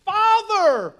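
high, loud cry of Father!. Acted. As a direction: call out to him as a son. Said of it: "He even cries out for him".

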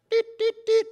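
high mock microwave beeping; microwave beeps. Preacher mocking quick conversions with high microwave beeps. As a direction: chirp it like a microwave timer.

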